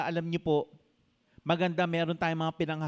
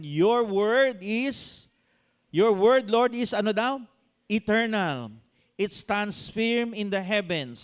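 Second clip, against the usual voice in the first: slow, drawn-out scripture reading; 5 semitones higher, 5 dB louder, slower than usual. Acted. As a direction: read the verse slowly and grandly. Reads scripture in a preaching voice. (mabagal na pagbasa ng talata)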